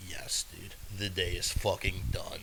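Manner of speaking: soft, low sigh of relief; quietly savoring the end of the day; relieved, worn-out. Acted. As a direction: sink into it, hushed and lightly acted. Winding down after work.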